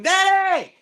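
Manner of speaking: high, drawn-out mock wail; acted cry for Daddy. Acted cry for family in joke.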